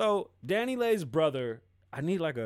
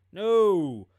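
loud flat no; 4 semitones higher, 10 dB louder than usual. Real, not acted. Emphatic denial.

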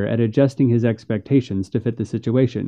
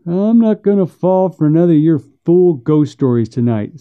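higher, dismissive backwoods voice; scornful brother; 6 semitones higher, 3 dB louder than usual. Acted. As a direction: brush off the ghost stories in a higher voice.

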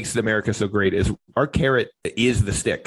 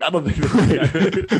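laughing; 4 semitones higher, much slower than usual. Real, not acted. Laughing while talking.

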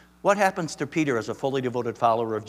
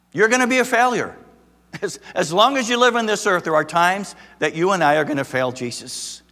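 higher and louder warning to the congregation; forceful preaching; 5 semitones higher, 6 dB louder than usual. Acted. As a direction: press the hard truth. Sermon climax.

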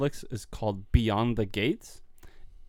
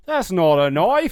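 put-on loud, slow Crocodile Dundee Australian accent; 6 semitones higher, 7 dB louder, slower than usual. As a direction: drawl it in a big Australian accent. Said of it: "in an Australian accent".

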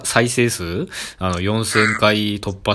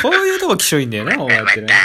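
high, loud laughing tease; 6 semitones higher, 4 dB louder than usual. Real, not acted. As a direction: laugh while you tease. Laughing while teasing his friend.

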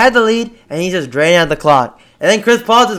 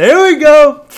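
high, loud fan cheer; excited yell, pitched up; re-enacted hype; 11 semitones higher, 6 dB louder than usual. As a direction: relive the excitement of a big dunk. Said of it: "but I was like".

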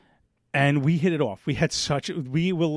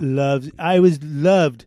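slow, louder, lightly voiced Trump; Trump impression; 7 dB louder, much slower than usual. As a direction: drag it out, self-satisfied. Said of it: "Mister Trump".

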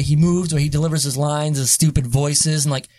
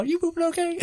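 worried mom voice; 12 semitones higher, 6 dB quieter than usual. Retold or imitated. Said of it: "She had to go like".

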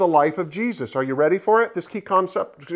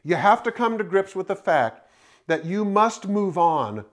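normal talking voice

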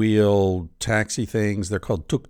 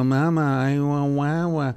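put-on high, halting toddler voice, stuck on the first sound; mock baby talk; 5 semitones higher than usual. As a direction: imitate a baby who fakes baby talk. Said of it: "talk that bullshit baby talk".

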